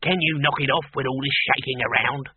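lightly voiced squeaky little demon; a touch of a tiny put-out imp; mild squeak of a small demon. A narrator's reading. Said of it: "squeaked the demon".

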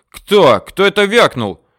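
loud, high-pitched angry demand; outraged shout. A narrator's reading. Savage looks round for the heckler, outraged shout.

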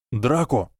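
ordinary delivery, nothing marked

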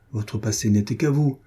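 ordinary delivery

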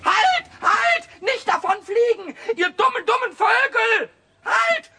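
sehr hoch und laut hinterherschreien; aufgeregtes Schreien. Acted. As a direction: schrei den fliehenden Vögeln nach. Tauben fliegen davon, Pumuckl schreit. (aufgeregtes lautes Nachschreien)

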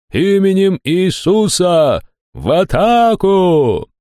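high, slow, drawn-out war cry; rally the troops, full voice; charge shout. A narrator's reading. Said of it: "заорал кто-то". (протяжный крик в атаку)

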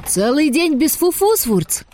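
high joyful proclamation. Acted. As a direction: announce it with glee. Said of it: "радостно провозгласил Оскар". (радостно)

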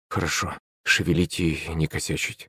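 soft, low, obedient reply; meek agreement. A narrator's reading. Yuri agreeing with his boss, meek and quiet. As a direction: agree quietly, keeping your head down. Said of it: "закивал Юрий".

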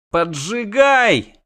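high, slow, stretched wail; howl. Acted. Yennefer howls at Geralt through the pain, slow and high. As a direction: howl the order. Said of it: "взвыла она".

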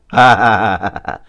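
loud, easy laughter; carefree laugh. A narrator's reading. The prisoner laughs freely after his eerie confession. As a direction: laugh it off without a care. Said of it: "ông cười thoải mái luôn".

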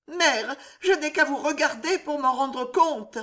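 trembling. A narrator's reading. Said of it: "fit Loïse tremblante".

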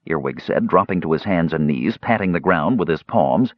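plain reading voice

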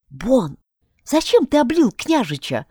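ordinary delivery

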